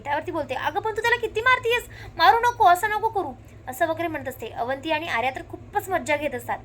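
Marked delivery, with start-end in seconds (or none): 0.5-3.3 higher, louder pleading; raised, insistent stop-it plea; urgent plea. Retold or imitated. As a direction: beg her loudly to stop. Pleading her to stop hitting.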